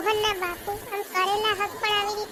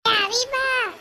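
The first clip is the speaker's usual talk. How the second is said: louder, higher answering call; calling back; 4 semitones higher, 5 dB louder, slower than usual. Acted. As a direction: shout back that you're coming.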